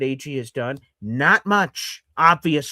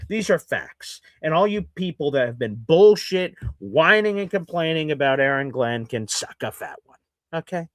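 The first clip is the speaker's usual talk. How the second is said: usual speaking voice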